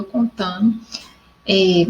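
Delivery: normal talking voice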